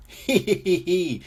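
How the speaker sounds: high hee-hee giggle; laughing. A narrator's reading. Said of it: "everybody laughs".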